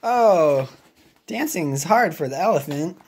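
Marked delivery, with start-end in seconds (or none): none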